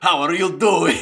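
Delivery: loud, high, laughing fake Russian; silly Russian impression; mock Russian accent. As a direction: ham up a Russian greeting. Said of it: "I can speak Russian".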